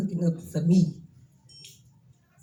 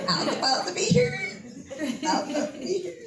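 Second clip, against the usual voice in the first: much higher, giggling the words out; laughing; 4 dB quieter than usual. Real, not acted. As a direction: laugh happily while saying it. Laughs about being in shop.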